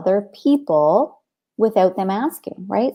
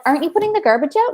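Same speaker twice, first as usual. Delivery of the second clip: higher, fast exasperated question; frustrated; 8 semitones higher, much faster than usual. Retold or imitated. Mother confronts her son about the garbage, higher and fast exasperation. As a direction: let the frustration push it up and quick. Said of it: "I'm getting frustrated".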